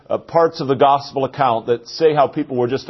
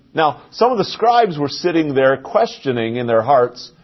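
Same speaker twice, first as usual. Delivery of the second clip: normal talking voice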